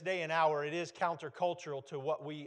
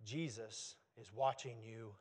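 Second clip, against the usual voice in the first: eerie voice; 7 semitones lower, 10 dB quieter than usual. Acted. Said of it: "he hears a voice saying".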